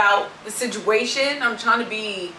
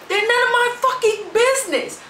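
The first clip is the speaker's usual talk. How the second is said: emphatic high pitch; 12 semitones higher than usual. Real, not acted. Emphatic list climax.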